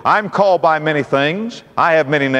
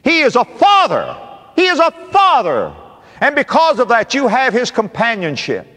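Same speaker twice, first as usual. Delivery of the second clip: high, forceful preaching; ringing pulpit delivery; 5 semitones higher than usual. Real, not acted. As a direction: proclaim it to the congregation with rising force.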